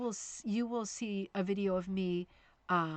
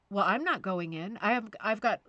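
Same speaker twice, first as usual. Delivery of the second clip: raised voice; 7 dB louder than usual. Retold or imitated. Retold arguing with daughter.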